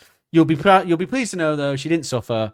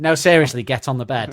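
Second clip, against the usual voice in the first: mock menacing order; 8 dB louder than usual. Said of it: "pulls out a gun and goes".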